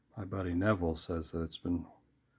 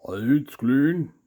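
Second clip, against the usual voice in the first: slow, higher, loud tough-guy mumble; bad Sylvester Stallone; 7 semitones higher, 10 dB louder, much slower than usual. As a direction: attempt a Stallone and miss. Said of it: "I can't do a Sylvester Stallone".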